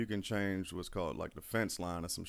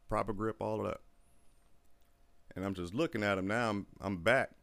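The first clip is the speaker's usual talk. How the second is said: normal talking voice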